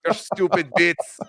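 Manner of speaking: put-on wandering Irish-Scottish-Australian accent; badly faked Irish, drifting toward Australian; muddled Irish accent. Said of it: "I don't know what accent I'm doing".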